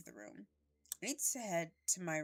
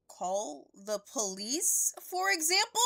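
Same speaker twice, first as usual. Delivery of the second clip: sarcastic; 8 semitones higher, 9 dB louder, slower than usual. Acted. Sarcastic retort in argument.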